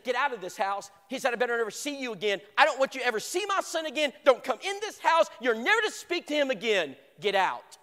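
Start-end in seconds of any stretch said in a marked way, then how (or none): 0.0-0.9 loud furious command; angry order. Retold or imitated. Violent father throws him out. 1.3-7.8 higher, loud, rising rage; furious shouting. Retold or imitated. Father throws boy out.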